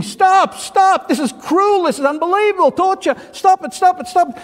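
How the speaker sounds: high, desperate cries of stop; a clay cup screaming for the potter to stop; pleading screams. Acted. Cup screaming at the potter, pleading high and loud. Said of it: "And he's screaming".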